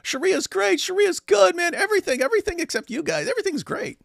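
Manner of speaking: very high, squeaky mock praise; mocking impression. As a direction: parody a gushing defender in falsetto. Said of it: "And this is the same guy".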